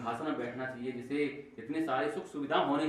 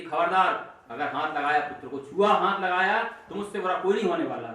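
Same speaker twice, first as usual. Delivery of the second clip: loud, raised-pitch stepmother's threat; stern warning; 3 semitones higher, 6 dB louder than usual. Retold or imitated. Stepmother forbids the king to touch his son, stern warning. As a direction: warn him off sharply, loudly.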